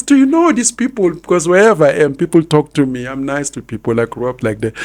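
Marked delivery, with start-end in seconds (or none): 0.0-1.1 put-on high child's voice, much louder; imitating his daughter. Mimicking his young daughter asking him, high. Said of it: "my daughter always, you know, says".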